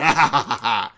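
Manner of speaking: short gloating villain laugh; villain chuckle. Acted. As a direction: chuckle at your own greatness. Villain laughs after boast.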